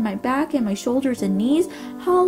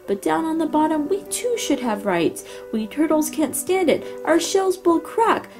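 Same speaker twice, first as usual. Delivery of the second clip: groaning plea; 6 semitones higher than usual. A narrator's reading. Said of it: "groaned Mac".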